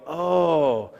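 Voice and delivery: oh held long and slow, round open vowel; slow oh demo. Shown as a demonstration. Teacher shows students the O sound, slow and held. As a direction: stretch out oh slowly for them. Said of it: "put it on your head".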